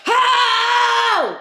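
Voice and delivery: mock the singalong with a high pop-star whoop. Mocking her friend singing along, high [name]-style shriek. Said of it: "screaming like [name]".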